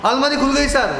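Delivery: high and excited, lock just clicked open; thrilled whisper-call that it opened; the safe is open, announced high and thrilled; excited high thief voice. Retold or imitated.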